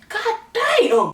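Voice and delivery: high, stunned god damn. Real, not acted. Teller reacting to the lady's scream, high shocked exclamation. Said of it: "I said".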